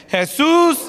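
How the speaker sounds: high, ringing call to Jesus; strong call. Shown as a demonstration. Pastor leads the congregation one last time, calling strongly. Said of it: "lo vamos a decir fuerte".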